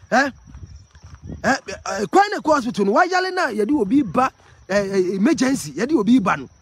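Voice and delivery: loud and high, complaining; heated loud rant about the road. Real, not acted. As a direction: call out over the road noise, worked up. Agitated vlogger shouting on the road.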